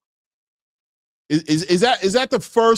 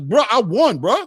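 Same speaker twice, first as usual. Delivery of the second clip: higher, louder mock protest; mock winner's protest; 7 semitones higher, 5 dB louder than usual. Host voices the winning fighter protesting to the referee, mock indignant. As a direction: play the winner whining at the referee. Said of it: "That's like".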